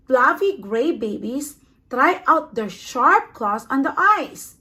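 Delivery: plain reading voice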